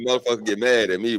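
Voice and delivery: ordinary talk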